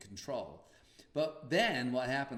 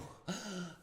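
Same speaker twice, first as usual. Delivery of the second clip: gasp; 8 semitones higher, 4 dB quieter than usual. Retold or imitated. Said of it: "All the mothers are just gasped".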